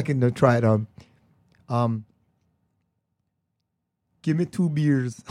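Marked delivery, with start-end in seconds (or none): 4.2-5.2 shaky stab at Scottish, nearly Jamaican; poor Scottish accent attempt. Shown as a demonstration. Imitating a friend's Scottish accent, badly. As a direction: try Scottish and miss. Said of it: "is it the Scottish accent?".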